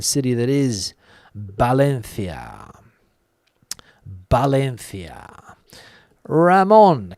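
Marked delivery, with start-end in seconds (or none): 1.3-2.7 slow, lower "Valencia" with Spanish B-like start and th; Spanish pronunciation. Shown as a demonstration. Streamer naming the listener's new city, "Valencia" drawn out with Spanish th. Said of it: "as they say here in Spain". 4.3-5.6 slow "Valencia" with a th for the c; Spanish pronunciation. Shown as a demonstration. Streamer repeating the city for the viewer, "Valencia" slowly with Spanish th. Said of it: "as they say here in Spain".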